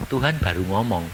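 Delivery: usual speaking voice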